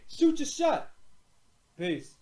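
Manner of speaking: ordinary talk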